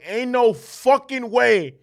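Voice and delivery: loud, slow, high outburst of disbelief; stretched-out shout of outrage; retold scream. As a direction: yell it in disbelief. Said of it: "I started screaming".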